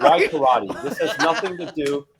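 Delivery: exasperated. Retold or imitated. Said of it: "And I'm just like".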